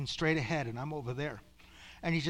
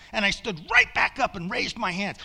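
loud, higher-pitched urgent telling; urgent shout; 5 semitones higher, 8 dB louder than usual. Real, not acted. Preacher telling how he stood back up, urgent raised shout. Urgent retelling after the outcry.

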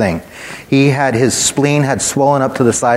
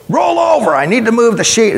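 high, raised-voice order to a patient; mock yelling, pitched way up; imitated nurse's yell; 10 semitones higher than usual. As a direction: call it out to someone barely conscious. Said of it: "they'd yell at him".

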